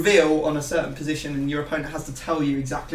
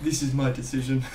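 lower, stiff robotic mock of a friend, laughing; deadpan like a robot laying down the law; lightly done Arnold-style robot voice, through laughter; Itani impression; 4 semitones lower than usual. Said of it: "why do you make me sound like Arnold?".

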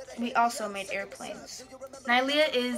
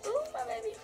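cooing; 13 semitones higher, 5 dB quieter, faster than usual. Real, not acted. Cooing over a baby photo.

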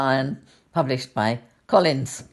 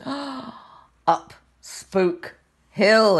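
spooky gasp; 7 semitones higher, much slower than usual. Acted. Scary climb in story.

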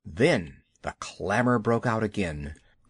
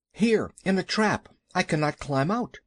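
lightly voiced trapped boy, higher pitch; Mowgli; 6 semitones higher than usual. A narrator's reading. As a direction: call out from the trap, pitched up. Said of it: "cried Mowgli".

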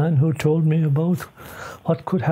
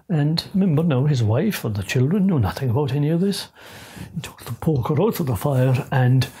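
plain reading voice

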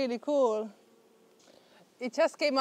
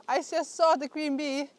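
ordinary delivery

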